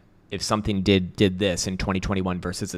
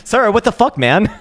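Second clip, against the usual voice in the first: laughing; 10 semitones higher, 10 dB louder than usual. Real, not acted. Laughing rant at suit guys.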